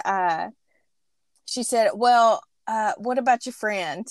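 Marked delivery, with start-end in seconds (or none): none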